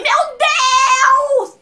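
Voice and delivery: very high, loud re-enacted scream; loud exclamation. Fan first seeing the star, loud starstruck exclamation. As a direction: shriek it in starstruck shock. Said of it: "eu falei um pouco alto".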